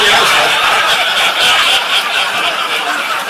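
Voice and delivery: stuck on a word, breaking up into breathless laughs; helpless laughter. Real, not acted. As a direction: try to speak but the laughter wins. Can't finish for laughing.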